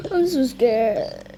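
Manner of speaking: slow, sobbing words; a frightened child crying; crying scared. Acted. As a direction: whimper it through tears. Kid crying in fear.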